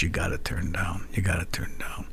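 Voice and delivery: whispered owner begging them to turn down; hushed plea. Retold or imitated. Club owner whispering at the band to turn down. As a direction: hiss it low and urgent.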